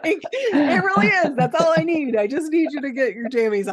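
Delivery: real laughter bubbling over; laughing while recalling her father's question. Real, not acted. Laughing at the punchline.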